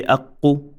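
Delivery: "aqu" with a held q and short final u; short vowel ending. Shown as a demonstration. Teacher modelling no extension, "aqu" ending short. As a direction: keep the u of "aqu" brief. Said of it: "here we say".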